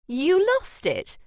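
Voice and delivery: high, drawn-out, amused disbelief; a touch of laughter in a high-pitched question; amused laughing question. A narrator's reading. Cassandra teasing Daniel about what he lost, amused.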